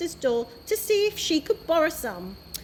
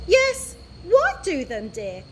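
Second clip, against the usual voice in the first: chiming in; 4 semitones higher, 3 dB louder than usual. A narrator's reading. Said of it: "chimed Mister Stink".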